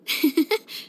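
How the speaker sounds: short, light giggle; happy chuckle. Acted. Happy to travel with friends.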